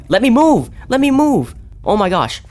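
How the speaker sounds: high, loud desperate shout; panicked yell. Real, not acted. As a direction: yell in panic to get free. Monster chasing, stuck.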